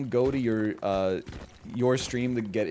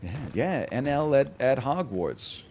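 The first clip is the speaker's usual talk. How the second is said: ordinary delivery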